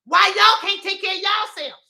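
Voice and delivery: loud, high, indignant rant; upset. Real, not acted. Speaker scolding followers for needing help, upset and indignant. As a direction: let exasperation spill over comically. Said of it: "I'm getting upset now".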